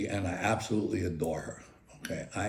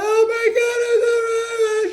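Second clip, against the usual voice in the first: shrill high panicked scream, much louder; wife screaming; 10 dB louder, much slower than usual. Retold or imitated. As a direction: mimic his wife shrieking at the dragonfly. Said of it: "my wife starts screaming".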